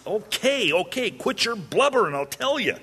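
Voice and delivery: high-pitched, worn-down husband; exasperated giving-in. Acted. Husband caving to his crying wife, exasperated. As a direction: give in noisily to the nagging.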